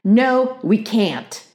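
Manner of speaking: cross big sister shutting it down; snapping. A narrator's reading. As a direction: snap a refusal. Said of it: "snapped Beezus".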